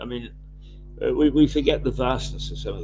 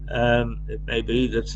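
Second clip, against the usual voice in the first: ordinary talk, nothing marked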